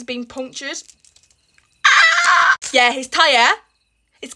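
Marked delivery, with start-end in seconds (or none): 1.9-2.5 high loud yelp of dismay; shouted ah of dismay; loud exclamation. Real, not acted. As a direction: cry out at the bad news. Tyre punctured.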